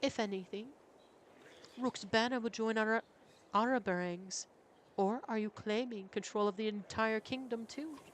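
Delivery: put-on young prince. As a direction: voice him high and defiant.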